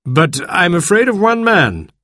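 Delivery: doctor lays out what he has heard; lightly voiced doctor. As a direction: speak as the measured, explaining doctor.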